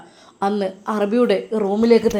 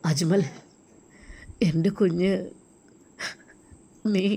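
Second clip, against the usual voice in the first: tearful; 2 semitones lower, 7 dB quieter than usual. Acted. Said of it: "എന്താണ് അങ്ങ് കരയുന്നത്".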